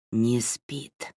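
hoarse, wheezing voice with a whistle; hoarse whistling rasp. Acted. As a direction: rasp it out like air through a cut throat. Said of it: "раздался хриплый, с присвистом".

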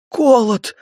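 begging for mercy; pleading. Acted. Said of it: "взмолился он".

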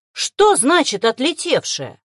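loud, slow, indignant demand; shocked near-squeal. A narrator's reading. Woman questions her departed partner, shocked and loud. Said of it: "практически взвизгнула Екатерина Алексеевна".

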